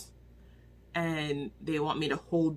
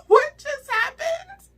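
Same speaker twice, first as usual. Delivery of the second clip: much higher, much louder and slow, laughing in shock; stunned disbelief; 11 dB louder, slower than usual. Real, not acted. Reviewer reliving the shocking scene, loud high stunned disbelief. Reacting to shocking book twist.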